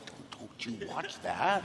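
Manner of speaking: put-on a mild, grave warning to a kid; stern warning. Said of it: "I said".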